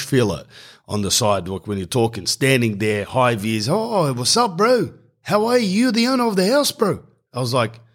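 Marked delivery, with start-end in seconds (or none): 3.7-7.0 put-on higher, lightly done Islander accent. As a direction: greet him with a light Islander lilt. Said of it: "big Islander guy greeting".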